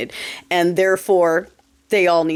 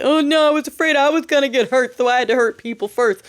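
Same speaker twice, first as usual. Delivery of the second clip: higher, whiny mock-fearful excuse; mimicking a frightened officer, hammed-up; mock scared cop; 4 semitones higher than usual. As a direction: parody a cop pleading fear. Mocking police excuse.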